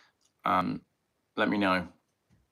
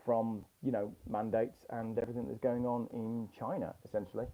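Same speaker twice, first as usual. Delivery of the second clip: dull, muffled mic sound; like talking through a cloth; muffled audio; 8 dB quieter than usual. Real, not acted. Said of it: "Very muffled, very muffled".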